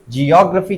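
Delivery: "Geography" as jee-OG-ruh-fee; stress demo. Shown as a demonstration. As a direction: open jee first, then stress the ऑ in "geography". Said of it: "क्या बोलते हैं इसको?". ("Geography" में ऑ पर ज़ोर)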